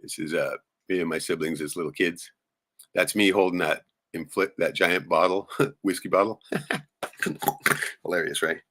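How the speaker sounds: ordinary delivery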